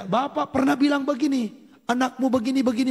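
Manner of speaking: acted questioning, pitched high; re-enacted interrogation; pressing question.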